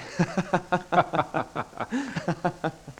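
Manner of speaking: a high laugh trailing off; nervous high laugh. Acted. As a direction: laugh off the joke. Laughs after the joke.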